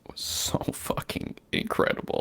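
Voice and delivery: awed, nearly whispered praise; hushed awe. Real, not acted. Fan praising the voice actors' performances, in hushed awe. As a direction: drop to a near-whisper in awe.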